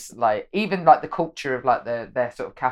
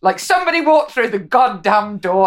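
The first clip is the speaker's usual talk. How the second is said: high, louder, fed-up outburst; exasperated Brit; 8 semitones higher, 5 dB louder than usual. Retold or imitated. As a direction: lose patience at the door standoff. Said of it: "very stereotypically British, isn't it, to be like".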